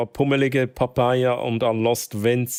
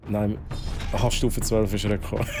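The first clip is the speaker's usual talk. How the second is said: normal talking voice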